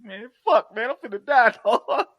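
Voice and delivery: high, loud comic terror breaking into laughter; mock panic. Imitating Steele about to shit himself, laughing mock panic. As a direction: play scared to death while laughing. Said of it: "Steele about shit himself".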